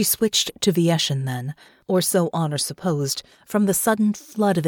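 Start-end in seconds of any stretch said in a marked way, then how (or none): none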